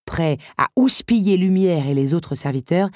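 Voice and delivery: ordinary delivery, nothing marked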